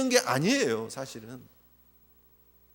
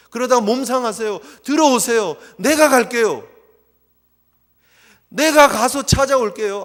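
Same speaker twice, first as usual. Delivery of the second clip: louder, urgent plea; eager pleading son; 5 semitones higher, 9 dB louder than usual. Retold or imitated. Elder son begging his father to come inside, louder. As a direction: insist earnestly, volunteering to go. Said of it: "큰아들은 무엇을 해야 합니까?".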